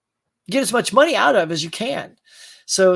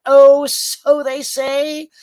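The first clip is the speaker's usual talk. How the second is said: sarcastic drawl; 6 semitones higher, 6 dB louder, much slower than usual. Real, not acted. Sceptical aside.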